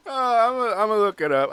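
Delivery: loud, high, still giddy from laughing; tickled. Real, not acted. Recovering from a laughing fit.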